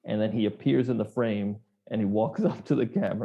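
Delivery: ordinary delivery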